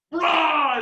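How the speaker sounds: loud, sudden roar; scare roar. Retold or imitated. Retelling John jumping out at the girls, scare roar. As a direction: burst out to frighten them.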